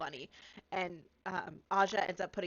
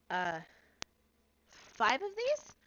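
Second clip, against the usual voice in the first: very high, slow, louder unsure question; puzzled little girl; 7 semitones higher, 6 dB louder, slower than usual. Acted. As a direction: hold up a coin, baffled and innocent. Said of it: "and she goes".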